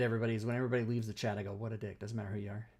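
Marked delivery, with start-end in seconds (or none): 1.5-2.0 quiet deadpan insult; mock insult. Shown as a demonstration. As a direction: mutter it like a running gag. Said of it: "that's my bit".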